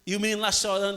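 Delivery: ordinary talk, nothing marked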